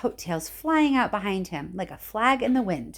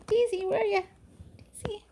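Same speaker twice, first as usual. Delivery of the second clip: high, whispered call to the dog; 12 semitones higher than usual. Real, not acted. Teacher whispering for her hidden dog, high.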